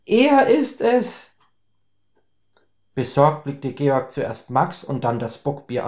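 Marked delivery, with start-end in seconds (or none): 0.0-1.3 high, slow, pointing in alarm; excited. Acted. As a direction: spell it out in disbelief, pitched high. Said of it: "aufgeregt zeigte Max". (aufgeregt, hoch und langsam)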